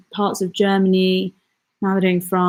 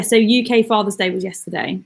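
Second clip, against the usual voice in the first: ordinary delivery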